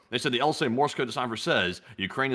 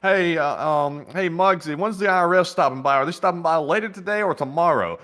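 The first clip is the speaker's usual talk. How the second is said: raised, louder voice calling across to a coworker; shouting over to employee; 7 semitones higher, 6 dB louder than usual. Acted. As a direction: call out pointedly so the mob boss overhears. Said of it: "I'll just simply shout over to an employee".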